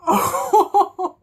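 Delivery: high, short laugh of heartache; pained laugh. Real, not acted. Fan laughing at a painful line, high and short. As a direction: laugh through the hurt. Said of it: "That hurt".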